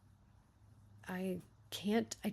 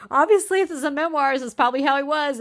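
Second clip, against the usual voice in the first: loud, high-pitched burst; emphatic concession; 6 semitones higher, 16 dB louder, faster than usual. Real, not acted. Reader conceding it is a memoir, loudly and emphatically. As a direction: jump in loudly to concede the point. Animated reaction, hedging her criticism.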